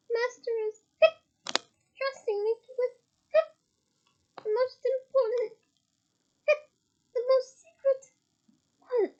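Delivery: high, pleading house-elf voice; a tiny tipsy elf fretting over her master; high elf squeak; squeaky elf. A narrator's reading. Winky boasts of secrets.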